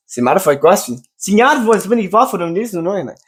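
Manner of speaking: high-pitched mock foreigner talking Portuguese; in character. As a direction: play the foreigner who speaks no English. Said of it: "But I broke character".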